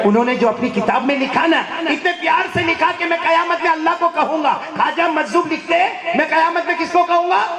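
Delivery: high, loud impassioned delivery; fervent preaching. Acted. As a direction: sweep the hall toward the verse. Climax before the sung verse. (پُرجوش بلند خطابت)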